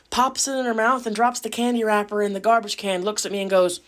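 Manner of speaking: ordinary talk, nothing marked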